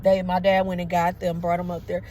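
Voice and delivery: ordinary talk, nothing marked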